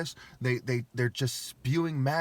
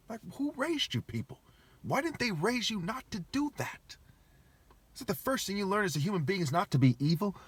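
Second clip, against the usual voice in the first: high-pitched outrage; 8 semitones higher than usual. Real, not acted. Ranting to viewers about cruel occultists, high shocked outrage. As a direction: voice jumps way up in indignant disbelief.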